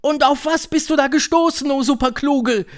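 high, loud eager witches; high-pitched, excited crowd voice; crowd calling out. A narrator's reading. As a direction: call out beseechingly to the leader. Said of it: "riefen sie".

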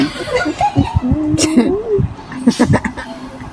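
laughing out, can't hold it; giggling fit; high laugh. Real, not acted. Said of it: "nakakalimutan kasi niya na bawal tumawa". (tawang hindi mapigilan)

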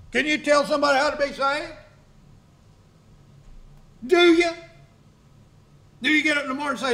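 full-force preaching. Acted. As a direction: hammer the congregation with questions.

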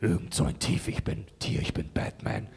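low growling beast voice; deep rasping growl. Shown as a demonstration. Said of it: "so tiefes Growlen".

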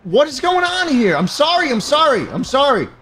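high startled sorry, said over and over; high-pitched flustered sorry; startled apology. Real, not acted. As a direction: blurt apologies in alarm. Game crowd screams at him.

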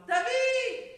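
loud, high drawn call; mother hollering her son's name; shouted summons. Acted. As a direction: yell to wake the sleeper. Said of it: "עוד פעם".

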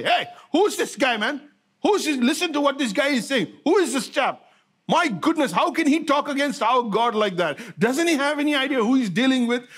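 put-on outraged kid demanding answers; young kid. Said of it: "a young kid from the village".

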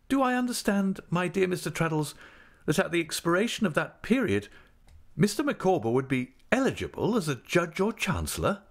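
a hint of a grand, fussing wife; Mrs Micawber voice. A narrator's reading.

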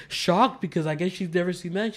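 ordinary delivery

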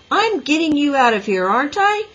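loud, high-pitched exasperated scolding; ordering. A narrator's reading. Boy scolds girl while struggling through mud, exasperated. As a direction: argue back in exasperation. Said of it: "he ordered".

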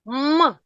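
М hummed by itself; isolated consonant held. Shown as a demonstration. Teacher naming the third sound, М hummed alone. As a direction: hold a short hum on М. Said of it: "про звук М".

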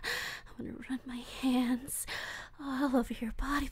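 fast breathless whisper. Acted. Captor whispers longing to tied-up Wolfie, fast and hushed. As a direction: hiss your desire close to his face.

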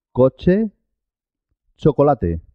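"Coche, chocolate" with tch for each CH; CH sound. Shown as a demonstration. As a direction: say "Coche, chocolate" as KO-cheh, cho-ko-LA-teh. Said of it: "En este caso suena che".

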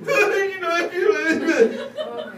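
hammed-up high, loud crying; mock sobbing, too choked to talk; blubbering. Preacher imitating himself crying in the bathroom, mock blubbering. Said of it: "I'm just this blubbering snot blob".

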